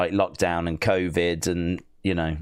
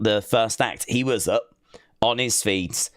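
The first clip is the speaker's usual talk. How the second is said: ordinary talk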